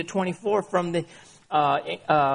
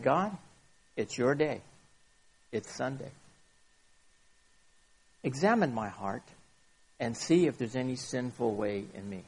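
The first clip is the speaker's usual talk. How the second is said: quiet prayer; 5 semitones lower, 7 dB quieter than usual. Retold or imitated. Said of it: "and you said".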